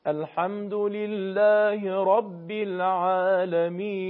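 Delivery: slow measured recitation, the alef in "العالمین" kept thin; light long alef. Shown as a demonstration. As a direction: draw out "العالمین" without making its alef heavy. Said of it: "الف مدی به صورت درشت و پرحجم تلفظ نمیشه". (الف «العالمین» کشیده و نازک، نه درشت)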